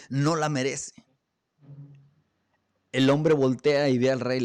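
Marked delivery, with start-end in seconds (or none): none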